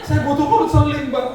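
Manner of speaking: tearful plea. Retold or imitated. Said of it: "Tiba-tiba dia nangis".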